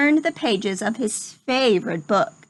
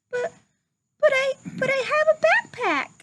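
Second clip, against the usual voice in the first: pleading little pig; 14 semitones higher than usual. Acted. Rufus pleading with the principal, high stammering piglet voice.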